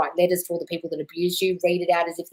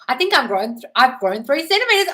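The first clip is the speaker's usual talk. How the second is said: laughing joke; 8 semitones higher, 7 dB louder than usual. Real, not acted. Joking about feeling proud.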